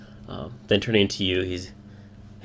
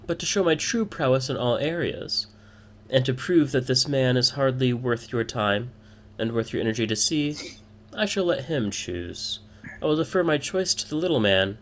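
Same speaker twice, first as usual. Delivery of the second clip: higher-pitched voice of a proud woman; 5 semitones higher than usual. Acted. Woman defers the choice to a rival, proud and higher. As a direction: show off prowess, pitched up. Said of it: "she admits".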